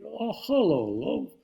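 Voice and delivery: a hint of Hockney's friendly phone greeting; David Hockney impression. Hockney answers the phone to Michael, light friendly impression. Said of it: "that's a great impersonation".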